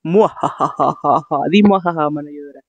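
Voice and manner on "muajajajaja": slow mad scientist cackle; evil laugh. Pretend. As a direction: cackle like a villain. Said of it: "Vamos a hacer un dúo".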